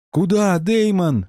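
high, slow despairing cry; desperate cry. Acted. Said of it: "в отчаянии вскрикнула Коралл".

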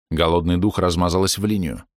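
ordinary delivery, nothing marked

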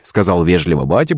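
usual speaking voice

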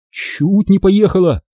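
high, slow, timid voice; trembling babble. A narrator's reading. Shaken private answering his senior, trembling high voice. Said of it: "дрожащим голосом пролепетал сапог".

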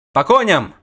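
loud barked order; loud commanding cry. A narrator's reading. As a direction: order the men to mount. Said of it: "скомандовал Геленстерн".

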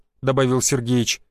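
ordinary delivery

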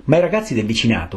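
ordinary delivery, nothing marked

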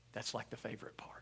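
whispered aside to the congregation; hushed, breathy confiding aside. Real, not acted.

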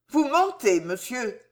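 usual speaking voice

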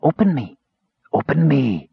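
high, eerie calling voice; talking box. A narrator's reading. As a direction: call out like an enchanted box. Talking box calls from the pouch.

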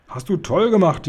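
high, delighted cheer; lightly acted girl's glee; jubilant. A narrator's reading. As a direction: cheer with joy, pitched well up. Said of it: "jauchzte Sophiechen".

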